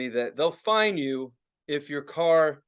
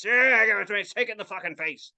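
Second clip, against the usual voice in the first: put-on growing-bold fan voice; crazed fan; 3 semitones higher than usual. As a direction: egg on the exhaust like a die-hard. Imitates fans relishing the fumes.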